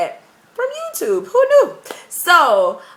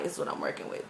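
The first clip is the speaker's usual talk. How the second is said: whispered aside; 14 dB quieter, much faster than usual. Real, not acted. Showing off her unstretched hair.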